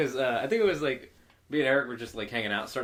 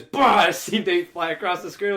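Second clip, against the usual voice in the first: ordinary talk, nothing marked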